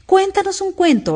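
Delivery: high, insistent demand; pushy storybook command; ordering. A narrator's reading. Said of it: "ordenó la liebre".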